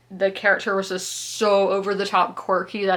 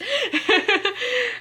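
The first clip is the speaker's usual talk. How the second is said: high laugh. Real, not acted. Laughing about the writer's rant, high giggle.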